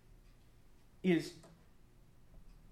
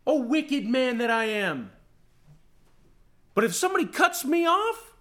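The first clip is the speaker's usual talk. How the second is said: normal talking voice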